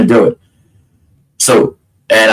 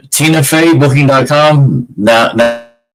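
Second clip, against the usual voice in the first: ordinary talk, nothing marked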